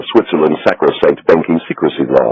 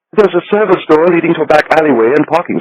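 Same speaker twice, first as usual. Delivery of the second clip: higher, fussy voice for the banker; little Swiss banker; 11 semitones higher than usual. A narrator's reading. Becker answers Gabriel.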